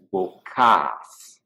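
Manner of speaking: vocas in two syllables, slowly, ending long; contrasting shorter form. Shown as a demonstration. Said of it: "That'll sound different than".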